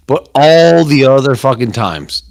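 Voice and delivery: usual speaking voice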